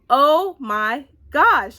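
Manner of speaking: very slow, loud, drawn-out amazement; loud, lingering reenacted awe; awed reaction. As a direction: stretch each word out in amazement. Retelling her reaction to the ending.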